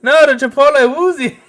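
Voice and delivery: high, loud nagging wife shout; shrill wife voice. Pretend. Cohost plays the Canadian fan's wife yelling from the background. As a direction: screech from the next room like an annoyed wife. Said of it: "his wife's in the background".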